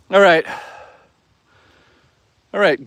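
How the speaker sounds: ordinary delivery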